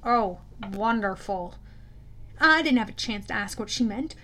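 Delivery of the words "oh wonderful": slow, lower, sour mutter; sarcastic mutter. A narrator's reading. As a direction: drip dry sarcasm under your breath. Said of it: "Talia muttered".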